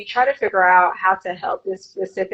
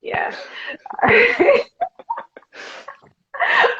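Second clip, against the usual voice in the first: normal talking voice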